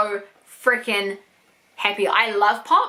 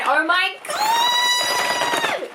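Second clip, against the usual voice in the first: long, much higher drawn-out cry of joy; ecstatic shout; much slower than usual. Real, not acted. Finding the doll she wanted for a year, an ecstatic shout. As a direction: stretch it into a thrilled shriek. Finds [name] pop.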